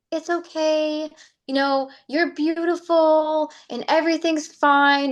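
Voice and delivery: soothing tone. Retold or imitated. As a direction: coo it like comforting a child. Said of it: "that same tone".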